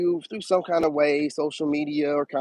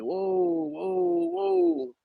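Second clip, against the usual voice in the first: mock amazement; 13 semitones higher, much slower than usual. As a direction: float up high as if marvelling. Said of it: "you're just like".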